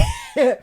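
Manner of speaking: high wheezing laugh. Real, not acted. Picturing the Uber driver listening, helpless laughing. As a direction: break off into squeaky laughter. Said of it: "I'm laughing because I can just imagine".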